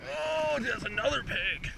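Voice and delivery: high, thrilled exclamation; excited. Real, not acted. Angler exclaims over another big fish, excited.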